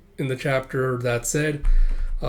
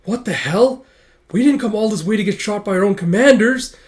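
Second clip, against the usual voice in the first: loud, high indignant shout; outraged protest; 8 semitones higher, 8 dB louder than usual. Acted. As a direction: protest in outrage under fire. Soldiers outraged under fire.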